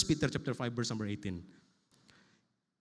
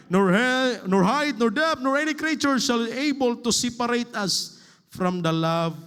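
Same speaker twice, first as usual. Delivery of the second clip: higher and louder with each word; fervent preaching list; preaching build; 6 semitones higher, 4 dB louder than usual. Acted. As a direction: hammer out each trouble, rising. Scripture read at full force.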